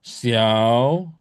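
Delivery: XIAO drawn out, dipping then rising; long scooping XIAO; swung third tone. Shown as a demonstration. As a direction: swing XIAO slowly upward from a dip. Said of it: "Xiao-nya ayun".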